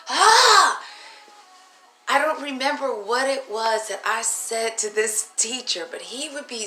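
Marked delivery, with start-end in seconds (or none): 0.1-0.8 loud high yelp; startled yelp. Retold or imitated. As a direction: yelp as the ruler hits. Ruler strike yelp.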